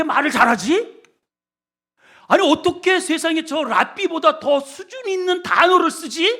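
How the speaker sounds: high, loud astonished disbelief; amazed crowd. Retold or imitated. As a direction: gasp it out as if you can't believe it. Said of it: "유대인들이 놀란 게 왜 놀랐냐면은".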